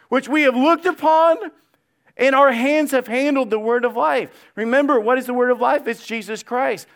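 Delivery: loud, high, fervent preaching; preaching at full force. Acted. Preacher to congregation, impassioned loud preaching. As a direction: drive the scripture home with fire.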